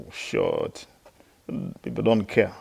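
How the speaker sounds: usual speaking voice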